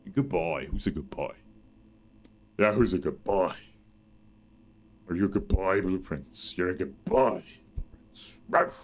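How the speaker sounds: low, cooing pet-talk voice; baby talk to a pet. Pretend. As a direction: coo at him like a dog owner. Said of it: "That was vastly out of line".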